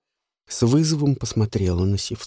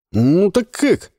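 pushy taunt; 8 semitones higher, 5 dB louder, slower than usual. Acted. Жуан требует ответа.

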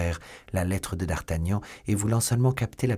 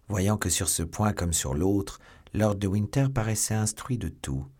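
plain reading voice

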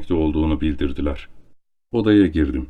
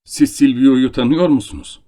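louder and higher, urgent; startled count presses for an answer; agitated question; 6 semitones higher, 5 dB louder than usual. A narrator's reading. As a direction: demand it, still shaken. Count shocked, presses the guest.

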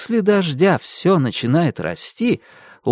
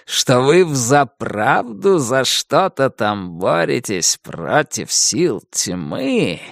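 menacing darkness voice; 2 semitones lower, much slower than usual. Acted. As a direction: gloat slowly as ancient evil. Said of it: "голос продолжал говорить".

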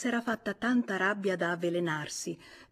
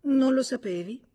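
honeyed question, pitched up; 6 semitones higher, 6 dB louder than usual. A narrator's reading. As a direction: ask it with mock-sweet innocence, voice lifted. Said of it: "annui mellifluo e aggiunse". (tono mellifluo, acuto)

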